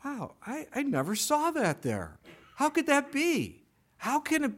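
high, wondering wow at a new insight; amazed discovery. Retold or imitated. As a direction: marvel at it as if seeing it first time. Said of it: "I'm going".

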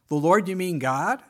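loud surprised question; can't believe it's God; incredulous. Retold or imitated. Jack on the branch, loud and disbelieving.

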